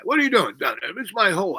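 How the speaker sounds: high, loud gangster challenge; mock gangster yell. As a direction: bark it like a tough guy guarding his turf. Gangster yelling at him in pit.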